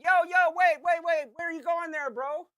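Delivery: high, loud call after him; mock confrontation shout. Calling after the kid outside the bar, loud mock shout. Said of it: "I put on such a production".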